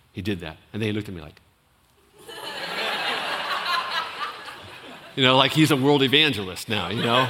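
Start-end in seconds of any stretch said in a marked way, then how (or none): 2.3-4.5 a high, soft laugh of delight. Real, not acted. Imitating Kevin's proud look.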